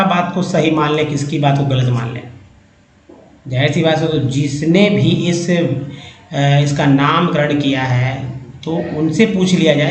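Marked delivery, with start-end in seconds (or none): none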